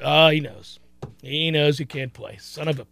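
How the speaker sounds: low, soft, sinking realization; resigned sideline grumble; muttered dismay. Acted.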